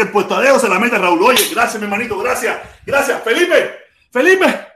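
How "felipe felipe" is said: excited calling. Real, not acted. Calling out to Felipe.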